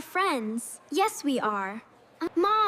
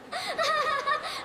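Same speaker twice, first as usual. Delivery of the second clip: mocking giggle; 10 semitones higher than usual. Bullies mock Lily.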